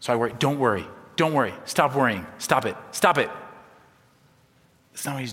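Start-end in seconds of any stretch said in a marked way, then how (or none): 0.4-3.3 short, insistent scolding bursts; nagging parental command. Acted. Preacher reading a nagging parent into Jesus, insistent scolding. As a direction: fire off each order like an irritated parent. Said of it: "I sometimes read that into Jesus".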